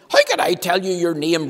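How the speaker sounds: loud exasperated retort; roaring. Acted. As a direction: roar it at him in exasperation. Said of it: "roared Mister Clever".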